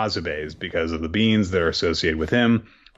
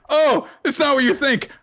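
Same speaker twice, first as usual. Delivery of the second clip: squeaky guilty excuse; 13 semitones higher than usual. Retold or imitated. Caught with the beans, a squeaky much higher guilty excuse. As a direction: babble innocence with beans in hand.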